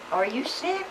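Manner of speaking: put-on high, soft worried question to a child; worried mother. Mother gently asks her shaking little boy, high and soft.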